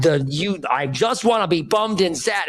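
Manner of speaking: high-pitched, frustrated protest; angry. Acted. Sad friend to the positive spinner, high and angry. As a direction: blurt it out in acted exasperation. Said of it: "that anger thing".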